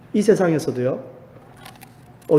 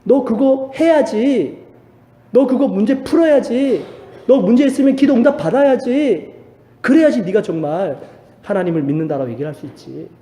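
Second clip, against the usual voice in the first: demanding devil; 6 semitones higher, 4 dB louder than usual. Retold or imitated. Devil pressuring believers to earn proof, loud nagging demand. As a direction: push like an accuser insisting. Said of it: "계속 공격합니다".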